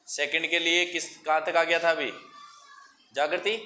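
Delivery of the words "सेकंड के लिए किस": normal talking voice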